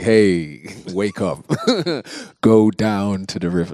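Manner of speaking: playful, booming God voice; God's voice. Pretend. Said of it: "you're not gonna hear God's voice".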